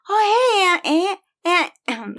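put-on high, sweet little kid greeting; child's voice. Nephew grabbing his aunt's hand, high childlike voice. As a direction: greet your aunt like a small boy. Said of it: "and he's like".

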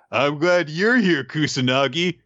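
moderately high voice leaning on YOU'RE; answering second character. Retold or imitated. Dora answers Kusanagi in kind, mock sentimental reply.

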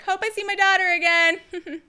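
milk the mother's goodbye; mom's over-the-top parting line; mock dramatic mom. Mom handing baby to coyote.

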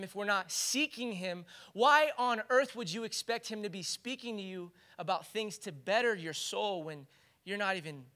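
ordinary talk, nothing marked

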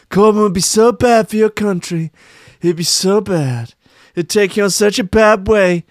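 spiral into a ranting Trump; Trump impression. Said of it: "channeling Donny T".

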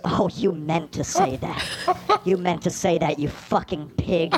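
low, soft icy menace; cold fury. Acted. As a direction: drop the voice, deadly quiet. Wife turns cold and low.

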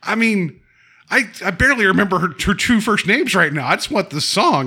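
high, pushed, fast dismissive rant; hammed-up brush-off of the woman; exasperated protest. Host imitating the singer brushing off the accusation, exasperated.

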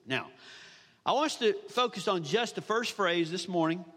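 ordinary talk, nothing marked

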